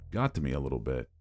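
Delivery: ordinary delivery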